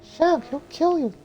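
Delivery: put-on high falsetto girl voice; squeaky high-pitched heroine; Mulan voice.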